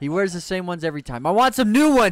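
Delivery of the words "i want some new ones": loud whiny demand. Pretend. Jokey demand for new glasses.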